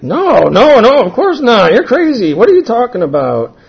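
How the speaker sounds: loud, high-pitched protest; indignant denial. Retold or imitated. Speaker denying to worried friend that they're getting high, indignant.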